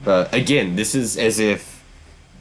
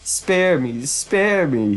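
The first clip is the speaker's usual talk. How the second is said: high, teasing echo of his plea; milder mock whine; mocking imitation of begging; 6 semitones higher, slower than usual. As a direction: mimic the coward's plea, pitched up. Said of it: "he says".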